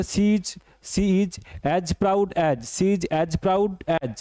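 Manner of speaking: very slow, careful reading; slow dictation. Shown as a demonstration. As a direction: drag out each word. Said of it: "teacher dictates the simile slowly".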